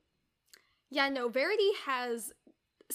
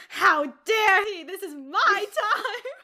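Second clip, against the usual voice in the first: slow, loud, higher hammed-up indignation; hammed-up jealous wife, offended; mock outrage; 7 semitones higher, 10 dB louder, slower than usual. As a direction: drag it out like a jealous diva. [name] outraged at husband.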